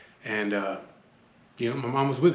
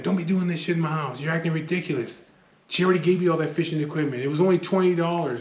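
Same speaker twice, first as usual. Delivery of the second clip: higher-pitched, louder grandma telling him off; retold scolding; 6 semitones higher, 4 dB louder than usual. Grandma to grandson fighting over money in her house, scolding. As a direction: imitate a grandmother's exasperated scolding.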